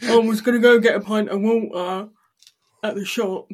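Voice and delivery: colloquial English accent. Shown as a demonstration. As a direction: put on a broad English accent. Demonstrates English accent on water.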